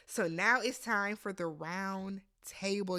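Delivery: ordinary talk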